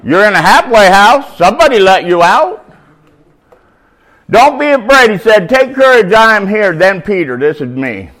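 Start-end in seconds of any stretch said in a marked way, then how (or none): 4.3-6.7 higher, louder calming words of Jesus; raised reassuring call. Acted. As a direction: call over the storm to calm them. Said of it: "Jesus spoke to them at once".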